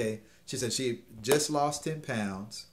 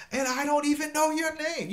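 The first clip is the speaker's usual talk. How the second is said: much higher, amused woman's reply; 4 dB louder than usual. Retold or imitated. Woman realizing she never got his name, much higher voice. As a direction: play her amused surprise lightly.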